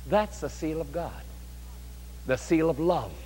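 ordinary delivery

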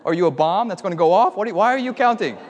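high, loud, stumbling questions; exasperated, rising in pitch and volume; frustrated bafflement. Retold or imitated. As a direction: demand to know what on earth he's doing. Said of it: "we were getting frustrated".

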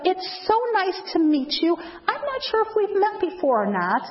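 high, bright welcoming voice; friendly greeter. Shown as a demonstration. Greeter welcoming unknown visitor at church door, high friendly. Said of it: "so I'll say to them".

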